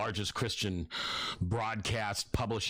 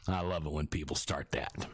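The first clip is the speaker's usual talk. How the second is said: ordinary talk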